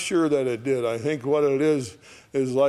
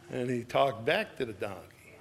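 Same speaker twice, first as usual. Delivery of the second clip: usual speaking voice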